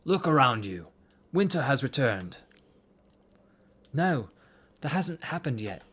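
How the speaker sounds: put-on a self-admittedly rough British accent. Duncan protests to Leith he'll succeed, in a bad British accent. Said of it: "I'm terrible at British accents".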